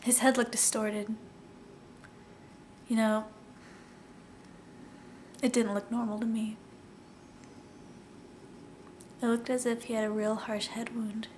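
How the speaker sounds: understated sad monologue of a police officer; halting testimony with long, heavy pauses; Reggie Flutie. Acted. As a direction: recount a grim discovery, subdued, pausing between phrases. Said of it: "I wanted to do a sad monologue".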